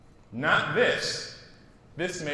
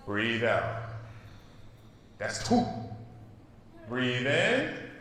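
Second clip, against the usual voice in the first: slow breathing, softer on each out; guided breathing; 4 semitones lower, 5 dB quieter, slower than usual. Shown as a demonstration. Teacher leading the class in deep breaths, softly. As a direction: lead the class through calm breaths. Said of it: "take deep breaths with me".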